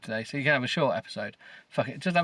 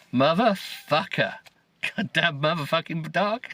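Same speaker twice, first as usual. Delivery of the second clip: loud, higher, drawn-out cursing; acted fury, pitched up; furious outburst; 5 semitones higher, 3 dB louder than usual. A narrator's reading. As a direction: let rip at the dog in outrage.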